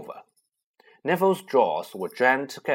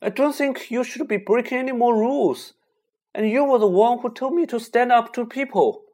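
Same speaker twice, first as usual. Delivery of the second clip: indignant; 11 semitones higher, 5 dB louder than usual. Acted. Neville standing up to friends.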